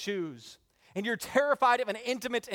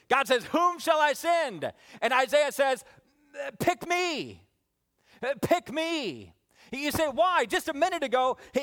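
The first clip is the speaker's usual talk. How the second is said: high-pitched, urgent sermon delivery; fervent preaching; 8 semitones higher, 3 dB louder than usual. Real, not acted. Preacher retelling Isaiah volunteering to God, fervent.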